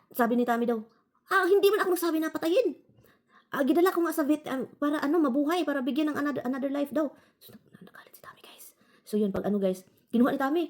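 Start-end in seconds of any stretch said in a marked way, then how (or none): 1.3-7.1 higher, upset objection; a touch of indignant protest. Retold or imitated. Husband protesting to the vet he never asked to put the cat down, indignant. 7.4-8.7 hushed confiding whisper; whispered aside. Real, not acted. Telling viewers her husband got angry, whispering.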